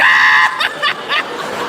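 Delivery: high breathless shrieking laugh; hysterical laughing. Acted. Cop dying in the middle of the freeway, shrieking laugh. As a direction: screech with helpless laughter. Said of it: "The cop is in the middle of the freeway dying".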